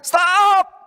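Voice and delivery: put-on sharp high yell of a startled child; retold shout. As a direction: shout it out, high and loud, to stop her. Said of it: "langsung berteriak".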